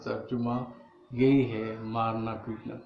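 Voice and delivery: normal talking voice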